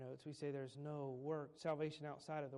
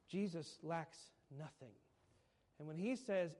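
normal talking voice

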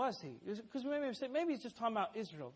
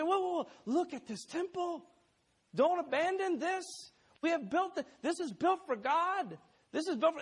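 higher, pleading protest; pleading disciples; 8 semitones higher than usual. Acted. Disciples begging Jesus to stay, higher pleading. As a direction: beg him not to leave.

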